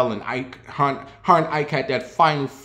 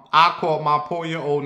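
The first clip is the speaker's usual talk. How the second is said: ordinary talk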